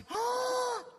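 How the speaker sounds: high drawn-out gasp; mock gasp of terror; shocked gasp. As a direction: suck in air in exaggerated shock. Felipe's reaction to the gun.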